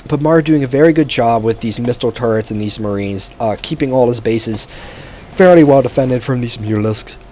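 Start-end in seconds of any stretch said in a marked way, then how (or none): 5.3-7.2 words stretched by a yawn. Real, not acted. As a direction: talk through a yawn. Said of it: "sorry about that yawn there".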